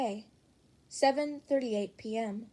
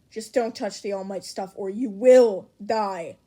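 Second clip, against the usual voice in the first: louder, deadly serious warning; loud threat; 10 dB louder than usual. Acted. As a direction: make the threat land. Warns about All Might stuff.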